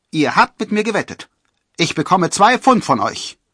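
very high, loud protest; indignant demand. A narrator's reading. Robin shouts his demand.

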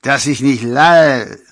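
put-on slow, loud drunken LALL; tongue-tied scoff, high and loud, slipping on LALL; tipsy slip. A narrator's reading. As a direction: draw out LALL like a drunk, dropping the end. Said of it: "verbesserte sich sogleich".

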